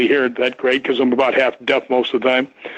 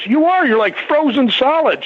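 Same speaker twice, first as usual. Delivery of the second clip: put-on shocked girl; 11 semitones higher than usual. Girl grabbing his arm, high mock alarm. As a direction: exclaim it like an alarmed young woman. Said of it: "she goes".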